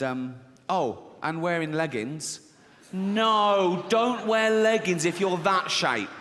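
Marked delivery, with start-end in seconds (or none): 2.9-6.1 louder, slower scolding; mock outrage. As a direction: scold her wardrobe, slowly. Outraged aside to the audience.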